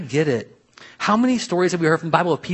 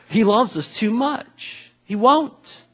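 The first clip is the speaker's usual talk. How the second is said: higher, drawn-out, rising plea; emphatic repeat; 7 semitones higher, much slower than usual. Real, not acted. Preacher answering why God won't give it, emphatic. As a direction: stretch it out high, insisting.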